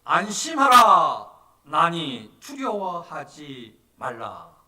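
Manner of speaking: loud, slow, drawn-out commanding call; Jesus. Acted. Jesus calling to the screaming disciples, loud proclamation. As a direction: proclaim it with slow, ringing force. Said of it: "즉시 이르시되".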